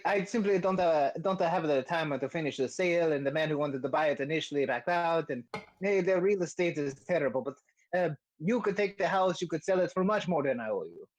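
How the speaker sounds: mock-Italian accent, making excuses; hedging Italian-accented character; Volo, bad Italian accent. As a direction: persuade them in a comic Italian accent. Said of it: "the bad Italian accent I was doing for Volo".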